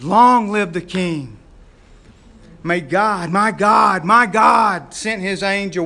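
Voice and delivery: loud, slow triumphant declaration; Daniel shouts up to the king from the den; proclaiming shout. Acted. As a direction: call up from the den, proud and slow.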